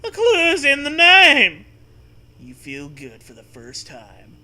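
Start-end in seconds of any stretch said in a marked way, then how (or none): 0.0-1.6 loud, much higher voice; loud high-pitched ghost horse. Acted. Ghost horse continuing cry.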